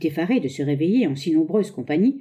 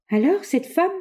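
higher, pressing question; insistent; 10 semitones higher than usual. Acted. Wife insisting to her husband about the woman. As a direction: demand it insistently. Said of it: "avec insistance".

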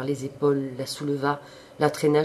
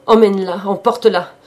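much louder, higher call; 5 semitones higher, 12 dB louder than usual. A narrator's reading. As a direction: shout for father to take her away. Jeanne calling her father.

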